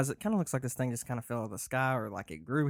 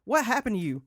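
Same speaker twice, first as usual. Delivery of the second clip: high, loud rapid questions; panicked and incredulous; frantic disbelief; 10 semitones higher, 6 dB louder, faster than usual. Acted. Keeps panicking at friend.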